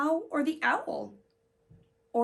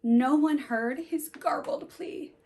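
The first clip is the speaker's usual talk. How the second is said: ordinary delivery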